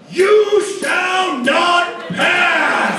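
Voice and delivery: very slow, high booming wizard shout. Retold or imitated. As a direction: boom it out like Gandalf on the bridge. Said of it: "old bearded gray wizard".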